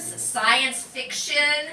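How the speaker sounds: normal talking voice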